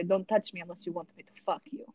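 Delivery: put-on quick, low, threatening Russian accent. To security dragging her out, threatening Russian accent. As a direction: warn them off in a Russian accent. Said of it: "with a Russian accent".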